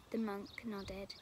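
usual speaking voice

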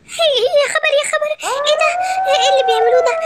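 high-pitched alarmed outburst; shocked. Acted. Lioness discovering the hyenas' plot, shocked. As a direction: gasp out the words in high alarm.

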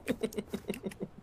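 soft unvoiced chuckle; breathy laugh. Real, not acted. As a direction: laugh under your breath.

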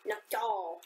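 badly faked Japanese accent on Nyokyo. Shown as a demonstration. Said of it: "I just do a really bad Japanese accent".

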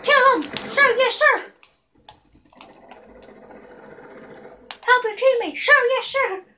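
high shouted drill-sergeant replies; loud gung-ho soldier. Pretend. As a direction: bark it like an eager recruit.

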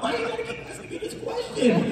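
very high, squeaky protest through laughter; hammed-up pleading squeal; mock exasperation. Retold protest at being pressured.

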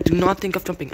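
quick, exasperated repeat of a warning; fast indignant telling-off; rushed scolding. Real, not acted. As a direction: rattle off the reprimand in one go.

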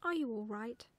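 plain reading voice

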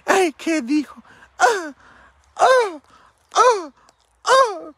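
high, loud scandalized church-lady gasps; mock the prudish churchgoers' shocked gasps; mock pious church lady. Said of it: "las santuronas que me dan agua bendita".

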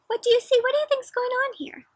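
put-on high, loud, eager question to a child; animated read-aloud question; excited question. Shown as a demonstration. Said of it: "asking child about cover".

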